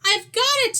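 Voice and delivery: high, loud little eureka; excited mouse. Acted. Mouse discovering his idea in bed, high and excited. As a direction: burst out with the bright idea.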